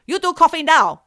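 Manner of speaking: loud, high imitation Chinese accent; broken-English demand, mock Chinese accent. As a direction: blurt it in a put-on Chinese accent. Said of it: "her Chinese accent ... it became".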